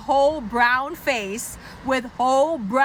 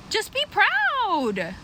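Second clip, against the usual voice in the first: hammed-up cheerleading; 7 semitones higher than usual. Comic urging people to be proud, hammed-up cheering. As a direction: hype them up in a high, stretched voice.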